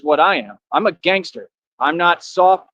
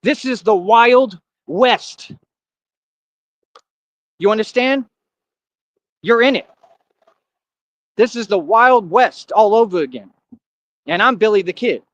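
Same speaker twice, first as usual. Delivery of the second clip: worked-up boast; 5 semitones higher than usual. Real, not acted. Gangster boast, Wild West threat.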